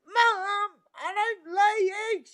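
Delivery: high, whiny daughter's protest; childish protest. Retold or imitated. Daughter protesting to her mum, high and whiny. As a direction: squeak it up high like a kid. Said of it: "And I'm like".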